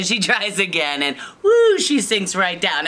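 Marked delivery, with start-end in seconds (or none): 1.4-1.8 high, loud sinking woo; playful falling whoop. Acted. Sinking into the soft bed.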